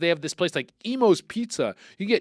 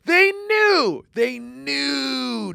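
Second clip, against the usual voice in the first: high, slow, stunned cry; shocked disbelief; 9 semitones higher, 4 dB louder, much slower than usual. Real, not acted. Host hearing the lead pipe facts, high stunned disbelief. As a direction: sound floored by the news. Learning about lead letters.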